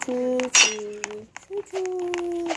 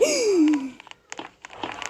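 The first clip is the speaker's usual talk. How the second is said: korkuyla nefes çekme; 2 semitones higher than usual. Real, not acted. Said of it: "Ödüm koptu".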